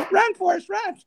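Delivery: high, loud call; movie quote shout. Retold or imitated. Comparing himself to Forrest Gump, cheering shout. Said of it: "The Real Forrest Gump".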